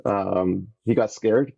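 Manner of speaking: usual speaking voice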